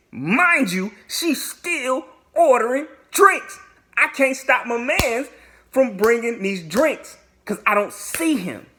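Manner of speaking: high, loud, emphatic complaint; exasperated exclaiming. Real, not acted. Telling viewers she's still ordering drinks, exasperated outcry. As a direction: cry it out in disbelief, word by word.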